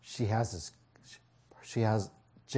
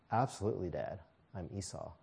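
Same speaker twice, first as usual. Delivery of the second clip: quiet lie; 4 semitones lower, 10 dB quieter, faster than usual. Acted. Jacob lying to father.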